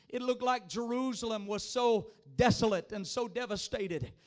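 ordinary talk, nothing marked

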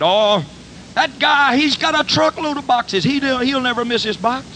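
high, eager excuse-making; excited self-justifying. Retold or imitated. Preacher excusing himself to the box.

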